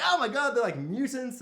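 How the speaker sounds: high, loud hammed-up alarm; mock shocked dinosaurs. Dinosaurs seeing fire-breathing dragons, mock shock. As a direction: gasp like a panicked dinosaur. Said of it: "and they're like".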